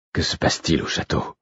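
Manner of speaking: murmure sans voix; chuchoté. A narrator's reading. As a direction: chuchoter pour ne pas être entendu. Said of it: "Elle parlait bas". (chuchoter la question à Patience)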